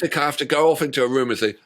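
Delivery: normal talking voice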